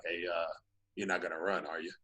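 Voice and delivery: very soft, low retold question; low-voiced cop. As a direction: imitate a cop asking discreetly, pitch dropped. Retold cop sidling up beside him.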